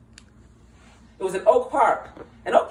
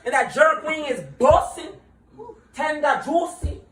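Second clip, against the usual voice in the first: Jamaican cook; 6 semitones higher than usual. Retold or imitated. Mocking a rude Jamaican cook demanding the order, Jamaican accent. Imitates Jamaican spot worker.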